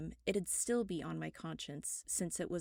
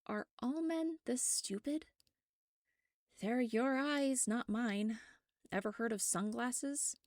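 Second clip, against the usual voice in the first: high, sarcastic exasperation; exasperated; 6 semitones higher than usual. Acted. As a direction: needle him for being so stupid. Exasperated at Michael.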